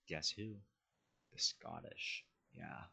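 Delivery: usual speaking voice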